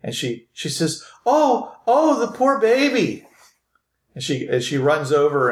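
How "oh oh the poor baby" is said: very high, drawn-out female voice; pitying lady. Acted. Lady finds Philip laying on the table, high pitying voice. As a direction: coo in pity, high and slow. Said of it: "you hear this female voice".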